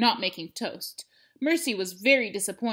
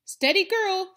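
cowboy calming horse; 11 semitones higher, 6 dB louder than usual. Acted. Calming the pig he rides.

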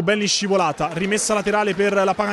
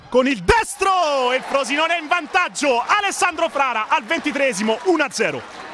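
goal call; 5 semitones higher than usual. Real, not acted. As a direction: shout the goal, drawing out destro. First goal scored.

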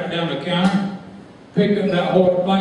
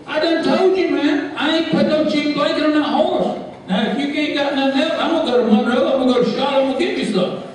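much higher, heated huffing; mock indignant cowboy; 8 semitones higher than usual. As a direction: storm off in a hammed-up huff. Cowboy threatens to leave.

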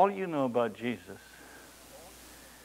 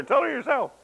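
mother snapping back; 13 semitones higher, 4 dB louder than usual. Retold or imitated. Parents quarrel, mother snaps back.

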